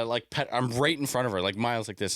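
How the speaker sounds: normal talking voice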